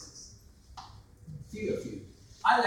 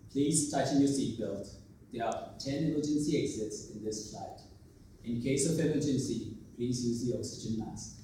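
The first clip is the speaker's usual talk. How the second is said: quiet, low monotone cabin announcement; boring flight announcement; 7 semitones lower, 5 dB quieter than usual. Retold or imitated. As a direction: drone it like a tired flight attendant. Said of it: "safety announcement came up".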